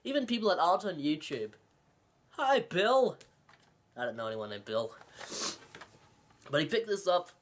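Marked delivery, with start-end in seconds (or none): 2.3-3.2 high, bouncy pretend hello; mock greeting. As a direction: call out a jokey shout-out, pitched up. Mock greeting to nonexistent Bill.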